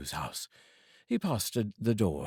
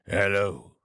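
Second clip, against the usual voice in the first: louder, gruff mock growl; feigned growl; 5 dB louder than usual. Scrooge feigning his old growl at late Bob. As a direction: fake the old grumpy greeting. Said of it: "growled Scrooge in his accustomed voice".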